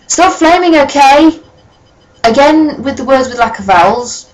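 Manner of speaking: high-pitched, fed-up plea; exasperated. Real, not acted. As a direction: tell the trolls off, exasperated. Snapping at commenters.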